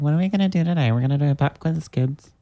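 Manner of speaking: high, far-off teacher voice; Linda the teacher. Retold or imitated. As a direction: chirp it like a cheery schoolteacher. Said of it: "You did sound like Linda there".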